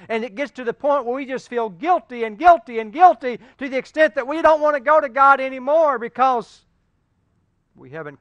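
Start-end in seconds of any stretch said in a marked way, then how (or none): 0.1-6.6 loud, high preaching climax; fiery pulpit crescendo; full-force preaching. Acted. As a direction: preach it out at the top of your voice.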